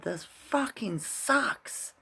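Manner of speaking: high, slow, drawn-out mock yell; mild mock yell of frustration; retold yell. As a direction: stretch it out high like you're egging him on. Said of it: "and I yelled at him".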